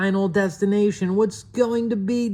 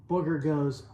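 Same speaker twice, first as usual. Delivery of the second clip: usual speaking voice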